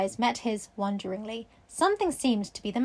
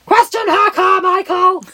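high, loud shouted command; frantic order; 7 semitones higher, 12 dB louder, slower than usual. A narrator's reading. Gentleman begs Carmichael to question the girl, frantic shouted order. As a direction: holler it as a desperate order.

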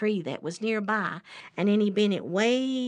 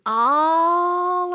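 "all" drawn out long and raised; stretched ALL; 7 semitones higher, 6 dB louder than usual. A narrator's reading. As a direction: draw out "all" in a swelling sweep. Storyteller stretches word for distance.

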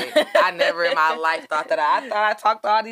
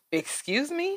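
much higher, shocked "excuse me"; offended "excuse me"; 10 semitones higher, 7 dB quieter, slower than usual. Retold or imitated. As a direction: rise in indignant disbelief. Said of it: "You was offended".

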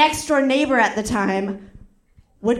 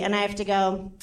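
ordinary delivery

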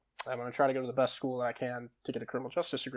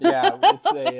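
laughing; 12 dB louder, slower than usual. Real, not acted. Laughing at nickname Fister.

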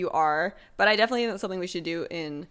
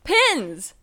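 excited; 9 semitones higher, 6 dB louder than usual. Real, not acted. Announcing the segment topic.

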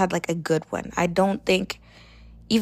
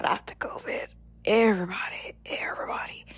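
whispering; 5 dB quieter than usual. Real, not acted. Whispered build-up.

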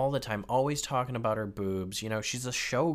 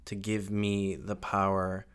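incantation. Pretend. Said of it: "hear my prayer".

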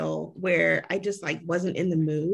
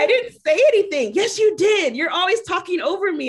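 louder and higher, hurt accusation; mock indignant; 10 semitones higher, 7 dB louder than usual. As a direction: whine that she always talks over him. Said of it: "I was like".

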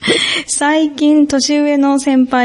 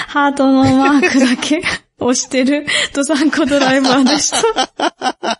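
laughing while reading; 3 semitones higher than usual. Real, not acted.

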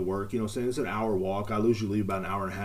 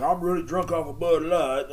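higher and slower, put-on tipsy voice; hammed-up drunk boast; mock drunk brag; 8 semitones higher, 5 dB louder than usual. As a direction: play a lightweight bragging he's wasted. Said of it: "so when you're like".

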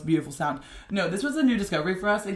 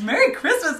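excited, laughing; 9 semitones higher, 9 dB louder than usual. Shown as a demonstration. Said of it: "redone take".

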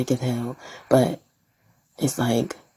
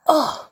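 disgusted ugh; 13 semitones higher, 4 dB louder than usual. Real, not acted. Disgusted groan at the idea.

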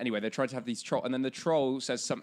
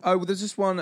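normal talking voice

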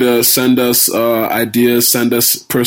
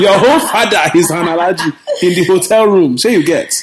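laughing; 6 semitones higher than usual. Retold or imitated. Acting out a friend's reaction.

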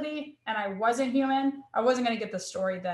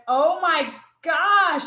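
drawn-out, louder, higher exclamation; moved amazement; 6 semitones higher, 7 dB louder, much slower than usual. Retold or imitated. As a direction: retell being floored, stretching the words out slowly. Retold reaction to offered prayer.

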